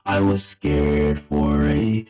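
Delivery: robotic vocoder with stepped, locked notes. Real, not acted. Player stuck as a vocoder, wanting the voice back, vocoder voice. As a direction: voice run through a vocoder effect. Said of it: "deal with my voice like this for a second".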